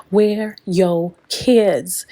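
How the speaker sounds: put-on "Where your kids?" very slow, word by word; drawn-out demand. Recapper demanding of Pasa where his kids are, slow and pointed. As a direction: stretch out "Where your kids?" with a gap after each word.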